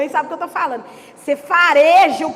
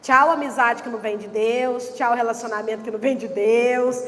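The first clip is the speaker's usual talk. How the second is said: lower, measured goodbyes; calm firm farewell; 7 semitones lower, 4 dB quieter than usual. Pretend. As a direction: wave each one off calmly. (dizer tchau firme e mais grave, com calma)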